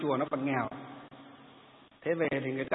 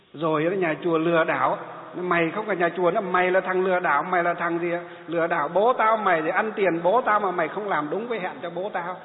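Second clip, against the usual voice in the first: louder, heated mock accusation; imitated angry yelling; 4 dB louder than usual. Son calling the monk a swindler, louder imitated furious shouting. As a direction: rant like an insulted son. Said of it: "Nó cứ thế nó chửi".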